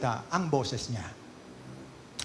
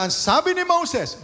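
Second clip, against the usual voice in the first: high, loud, slow cry of Moses' name; thundering preaching; 14 semitones higher, 6 dB louder than usual. Real, not acted. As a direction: proclaim it like a judgement from the pulpit.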